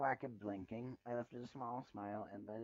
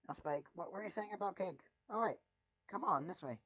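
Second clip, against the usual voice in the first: higher, louder, bubbling over; excited; 7 semitones higher, 8 dB louder than usual. Acted. Twilight urging Spike toward the cake, excited and high. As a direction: rush ahead eagerly toward the cake.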